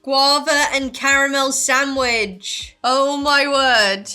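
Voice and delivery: loud, drawn-out delight at a discovery; amazed exclamation. Real, not acted. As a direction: exclaim it loudly and slowly, word by word. Reads out sandwich name, amazed.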